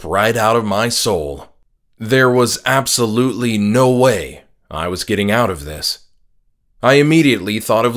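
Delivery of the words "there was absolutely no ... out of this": ordinary delivery, nothing marked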